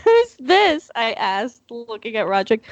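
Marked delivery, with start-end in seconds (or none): 0.0-0.9 squeaky little brother; high kid voice, louder. Acted. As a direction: pitch it up, a bit louder. Redo of brother line, louder.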